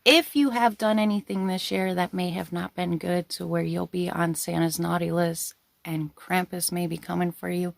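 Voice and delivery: usual speaking voice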